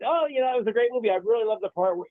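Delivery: high, quick, enthusiastic praise; celebrating. Acted. Parent talking up a movie's marriage ending to the kids, celebrating. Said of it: "and you celebrate it".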